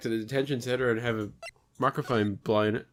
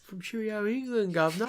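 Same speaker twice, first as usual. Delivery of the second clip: mock British accent; 8 semitones higher than usual. Player teases friend over lost accent, mock British. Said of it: "my British accent".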